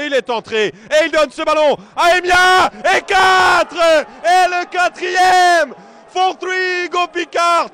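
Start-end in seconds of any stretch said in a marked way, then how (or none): 3.0-7.7 loud, thrilled shout of the fourth goal; excited sports commentary. Real, not acted. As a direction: bellow the score like the crowd. Goal call in live commentary.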